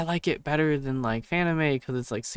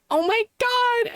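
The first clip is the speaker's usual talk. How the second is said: very high, drawn-out mock gasp of a crowd; mock shocked squeal; 3 dB louder, slower than usual. Imitating everyone shocked by porn at a panel, mock squeal. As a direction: squeal it like a scandalized audience, hammed-up.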